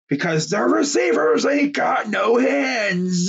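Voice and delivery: high, drawn-out ranting yell; exasperated shout. Real, not acted. Fan ranting about dropped passes, high exasperated yell. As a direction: wail it in hammed-up exasperation.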